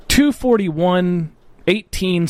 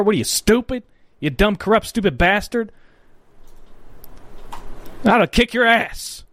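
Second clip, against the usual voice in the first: higher-pitched mock-threatening tirade; angry insulting rant; 4 semitones higher than usual. Podcaster ranting at Mike Pence over his vote, angry. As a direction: berate him with heated insults, pitched up.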